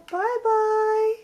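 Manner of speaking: high loud singsong goodbye; high singsong bye. Real, not acted. Owner saying bye-bye to her bird, high singsong.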